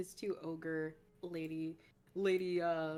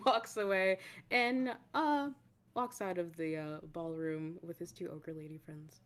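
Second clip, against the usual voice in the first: normal talking voice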